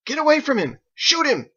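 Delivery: high, loud panicked crowd yell; shouting. Acted. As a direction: scream it at the crowd to get away. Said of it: "Others shouted".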